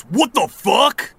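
stunned after falling. Acted. Bully shoved, falls, confused.

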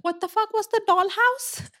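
high-pitched, baffled outburst; incredulous question. Real, not acted. Friends picking apart the plot, incredulous question. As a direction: demand an answer in disbelief.

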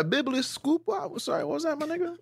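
squeaky, baffled voice; mock confused. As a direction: pretend you misheard. Mock confusion at big word.

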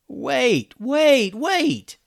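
high, alarmed yelling; urgent pleas climbing in pitch; yelled. A narrator's reading. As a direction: yell to stop them. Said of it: "yelled Ben".

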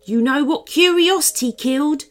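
nervous gulp. A narrator's reading. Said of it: "Woodrow gulped".

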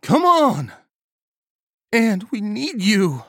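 high frightened cry, drawn out at the end; desperate, high-pitched appeal; voice cracking with fear. A narrator's reading. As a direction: plead loudly in terror. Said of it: "her voice cracking with fear".

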